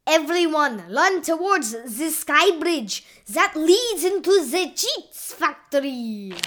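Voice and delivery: put-on loud, high Italian-accented command; urgent calling out. A narrator's reading. Waiter sending the crew to the sky bridge, urgent shout. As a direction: shout orders, stretching the final words. Mushroom calls orders during battle.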